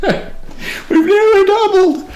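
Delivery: we've nearly doubled squealed very high through laughter; laughing squeal. Real, not acted. Joke about listener count.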